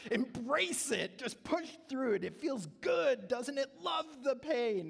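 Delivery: high, slow, mock-cheerful dad urging kids to love the pain; mock coach voice. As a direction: play the goofy dad coaxing a hurt kid. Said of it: "I would say".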